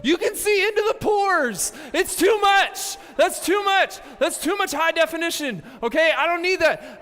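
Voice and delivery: high, loud hammed-up inner panic. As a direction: whine like the photo horrified you. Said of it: "Internally, I was like".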